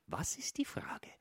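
whispered question in the theatre. Acted. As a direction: whisper it so the audience won't hear. Asking quietly during the play. (flüsternd im Theater)

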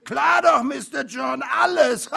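high, very loud mocking shout; uproarious heckling; jeering laughter. A narrator's reading. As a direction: shout it and burst out guffawing. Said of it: "brachen die Bullen ... in Gelächter aus".

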